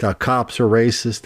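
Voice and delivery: ordinary delivery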